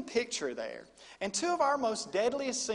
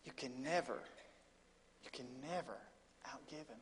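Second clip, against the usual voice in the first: much softer, fading almost to a whisper; hushed preaching; 6 semitones lower, 14 dB quieter than usual. Real, not acted. Preacher to congregation, softer and fading. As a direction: let the words sink to a hush.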